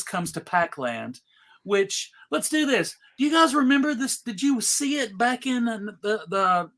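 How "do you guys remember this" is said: ordinary talk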